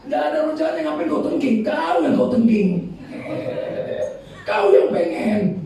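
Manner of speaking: ordinary talk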